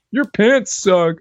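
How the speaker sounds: slow sneering commenter voice; drawn-out internet troll jab; YouTube comment jerk. Retold or imitated. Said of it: "I sound like one of those YouTube comment jerks".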